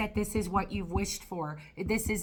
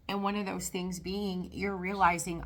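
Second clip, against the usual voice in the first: ordinary talk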